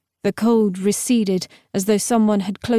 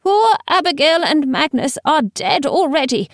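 frightened; 7 semitones higher than usual. A narrator's reading. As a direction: cut her off in fear. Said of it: "replaced by wild terror".

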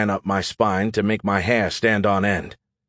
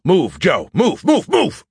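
rising frantic move, move; panicked urging; 7 semitones higher, 4 dB louder, slower than usual. A narrator's reading. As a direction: push them out, voice climbing.

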